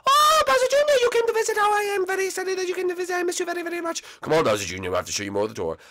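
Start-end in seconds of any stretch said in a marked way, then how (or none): 0.1-4.0 put-on very high, fast, overjoyed kid voice; tiny high voice bursting with joy at seeing dad; squeaky excited Lemmy. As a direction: squeal it out like an overexcited little cousin. Lemmy's voice greets Bowser Junior.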